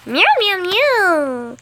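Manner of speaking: high, loud meowing; high cat voice meowing; cat meows. Pretend. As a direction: voice the pet cat. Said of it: "What do you have to say".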